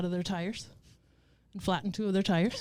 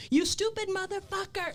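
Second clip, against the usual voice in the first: high-pitched, indignant retold rant; retold angry outburst; 12 semitones higher than usual. Camper yelling at the man on her hill, retold fury. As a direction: reenact losing it, voice pitched way up. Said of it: "I lost my shit".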